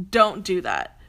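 usual speaking voice

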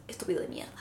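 a whispered curse under the breath; whispered insult. Real, not acted. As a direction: hiss the insult so nobody hears. Muttered insult at the website.

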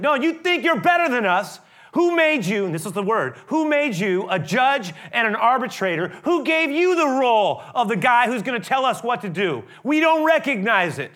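loud, high, scornful challenge, mocking the would-be judge; angry retort. The Hebrew man scorning Moses, loud and high scorn. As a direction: throw his authority back in his face. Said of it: "he said".

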